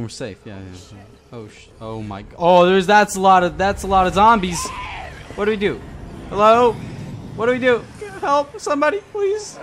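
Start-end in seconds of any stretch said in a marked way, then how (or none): 2.4-9.6 loud, high, climbing plea for help; panicked cry. Real, not acted. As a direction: call out desperately, pitch climbing to the end. Cornered by a lot of zombies.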